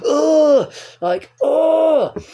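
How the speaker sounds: high, loud exasperated groan; frustrated groan. Retold or imitated. Lost among bus stops, a loud stressed groan. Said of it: "stressed out, pissed off".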